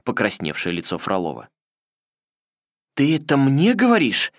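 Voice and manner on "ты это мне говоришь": higher, louder indignant yell; outraged shout. A narrator's reading. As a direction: scream the insult. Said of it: "крикнул Фролов". (возмущённый крик)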